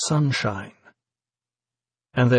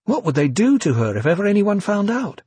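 rising panic; 8 semitones higher than usual. A narrator's reading.

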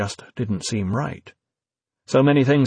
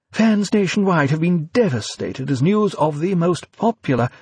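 news read; 7 semitones higher than usual. A narrator's reading. Newsreader voice on TV.

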